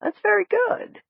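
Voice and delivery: high-pitched doctor coaxing a patient; high, encouraging doctor voice; murmuring doctor. A narrator's reading. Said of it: "I could hear Doc murmuring inside".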